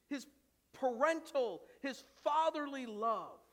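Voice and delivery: building, repeated sermon refrain; impassioned preaching. Acted. Pastor building the refrain for his church, impassioned.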